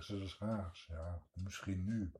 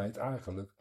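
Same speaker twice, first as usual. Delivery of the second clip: usual speaking voice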